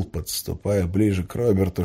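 ordinary delivery, nothing marked

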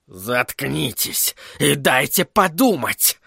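slow, restrained snarl; angry bark. A narrator's reading. Sorcerer silencing his parrot's two heads, barking. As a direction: snap at them, drawing the words out. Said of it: "рявкнул на них Флэк".

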